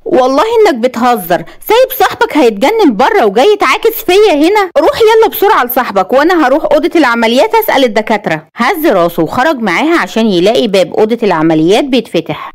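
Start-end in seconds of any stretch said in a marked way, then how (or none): 0.1-8.5 high, indignant female-character voice; sharp exasperation. A narrator's reading. Woman sends Khaled back to his friend, sharp vexation. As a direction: scold him, pitch jumping up. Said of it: "وتقول له بحدة وغيظ".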